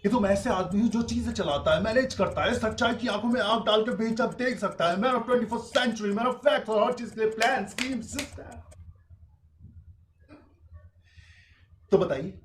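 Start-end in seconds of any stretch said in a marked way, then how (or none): 0.0-8.6 high, brisk swaggering patter; grand boasting. Acted. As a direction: rattle it off like a self-important man. Mimicking or boasting self-made man.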